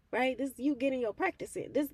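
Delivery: ordinary delivery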